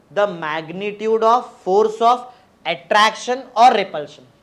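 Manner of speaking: very slow, word-by-word definition; spaced-out teacher's dictation. Real, not acted. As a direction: dictate it slowly for students to memorise. Dictating definition for class to memorise.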